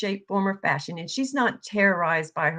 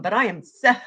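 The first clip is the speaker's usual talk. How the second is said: ordinary talk, nothing marked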